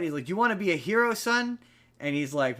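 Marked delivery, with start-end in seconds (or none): 0.3-1.6 higher, eager priest's pitch; imitating a pushy priest. Priest urging a skeptic to become a hero, higher and eager. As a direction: sell it like a hammed-up recruiter. Retelling the priest recruiting a man.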